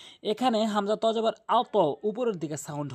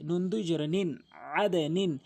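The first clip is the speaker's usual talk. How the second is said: ordinary talk, nothing marked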